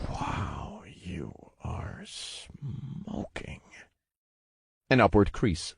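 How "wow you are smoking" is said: slow breathless whisper. Acted. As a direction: whisper it in awe. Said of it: "He let out a whispered".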